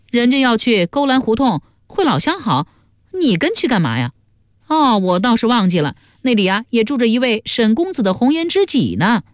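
high, mocking character voice; sneering tease. A narrator's reading. Teasing a companion about a lady friend, sneering. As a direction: needle them with feigned innocence. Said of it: "冷笑说".

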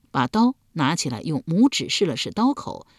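plain reading voice

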